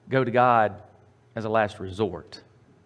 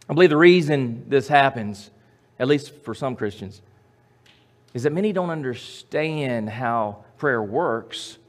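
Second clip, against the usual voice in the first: ordinary delivery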